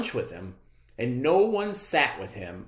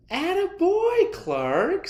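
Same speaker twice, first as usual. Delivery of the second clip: much higher cheery praise; proud teacher; 4 dB louder, slower than usual. A narrator's reading. Teacher praising Clark, high and proud. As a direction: beam it at him. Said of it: "said Miss Inkydink".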